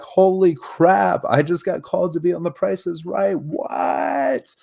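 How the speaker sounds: high, loud disbelieving squeal; excited freakout. Retold or imitated. Reliving his Price is Right call-up, shrieking excitedly. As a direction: freak out with joy. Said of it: "I'm like".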